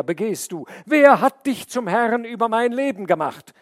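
man shouts at the snake, outraged; outraged cry. Acted. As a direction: demand an answer, outraged. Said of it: "rief er".

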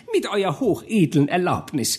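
high, reedy courtier pressing his lord; a serpent-tongued adviser, high and insistent; scheming chancellor. A narrator's reading. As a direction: chancellor urging the duke to sign the new law, high and wheedling. Chancellor voice held.

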